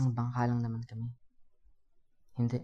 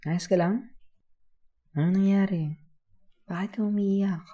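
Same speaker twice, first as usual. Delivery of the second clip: higher, louder worried kuya; raised, anxious-to-help questions; concerned questioning; 7 semitones higher, 6 dB louder than usual. Acted. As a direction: ask a crying child with open concern. Asks crying child what happened.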